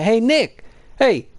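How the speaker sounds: high-pitched, hollered teacher imitation; mock teacher calling out. Teacher banishes a pupil to the corner, mock high holler.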